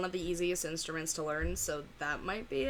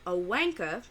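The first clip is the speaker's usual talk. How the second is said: a slow, put-on British accent on a wanka; mock British accent; 3 dB louder than usual. Viewer slips into a British accent while explaining British slang, mock British accent. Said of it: "I do not know why I donned that accent".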